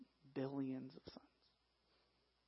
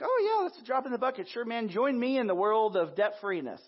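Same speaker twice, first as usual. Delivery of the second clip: breezy servant; 6 semitones higher, 4 dB louder than usual. Acted. Voicing the forgiven servant cheerfully.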